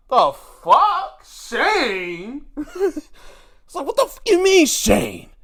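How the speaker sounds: loud, high, disbelieving outrage; mock-furious, choking on the news; Triple H outraged. Imitating Triple H hearing he faces Shane, loud outraged disbelief. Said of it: "Triple H was like".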